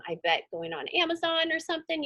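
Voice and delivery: normal talking voice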